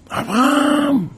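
high wake-up call. Retold or imitated. Beadle waking people. (קריאת השכמה גבוהה לאברהם)

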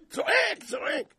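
high-pitched repeated shouts; mock screaming. Preacher imitates Pharaoh shouting in pain, mock screams. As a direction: yelp it out twice, hammed up.

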